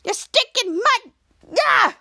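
high, slow, shouted rage; angry tantrum. Retold or imitated. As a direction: hammer the words out in fury. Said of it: "smacking the toolbox with anger".

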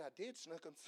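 a mild sugary I did Snookums, laughing; sweet, nicer. Pretend. Preacher answering coworker in heaven, mock sweet. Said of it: "I'll say it nicer".

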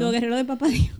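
a loud, exalted echo of a slogan. Real, not acted. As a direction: proclaim it back with fervor. Echoed Instagram phrase, emphatic.

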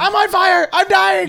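high, loud frantic shout; panicked movie scream. Retold or imitated. Re-enacting Will Ferrell's crash scene for the co-host, hammed-up panicked yelling. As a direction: scream it like a man convinced he's burning.

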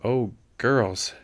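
ordinary delivery, nothing marked

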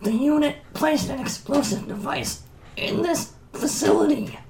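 high strained robot warning; urgent dying robot. Acted.

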